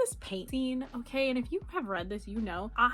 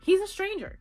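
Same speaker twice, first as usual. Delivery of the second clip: much higher and louder on stranger; exasperated, higher and louder; high exasperated cry; 9 semitones higher, 6 dB louder than usual. Real, not acted. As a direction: throw up your hands at it. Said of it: "repeated, higher and louder".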